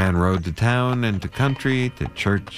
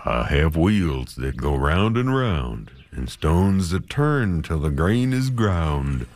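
miller's voice; slower than usual. Acted. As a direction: roll it out slow like a dusty old miller. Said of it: "he said".

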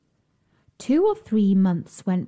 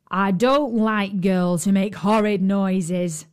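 giant voice; slower than usual. Acted. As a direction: menace her as a slow giant. Giant scolds crying princess.

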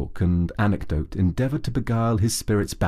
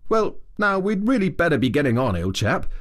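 breezy and quick, a bit higher; careless remark; 9 semitones higher than usual. A narrator's reading. Rat briskly suggesting they move on, carelessly. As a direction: toss it off lightly. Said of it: "remarking carelessly".

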